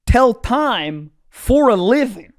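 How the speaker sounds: each word spaced out for weight; measured, indignant mock boast; slow, emphatic declaration. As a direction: spell it out slowly and pointedly. Acted boast, first slow take.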